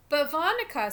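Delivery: put-on childish protest to the babysitter; little boy protesting. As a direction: plead like a little brother. Small brother protests to babysitter.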